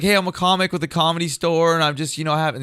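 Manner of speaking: over-eager attempt at playing it cool; trying to be cool. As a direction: act cool while wound up. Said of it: "I was just trying to be cool".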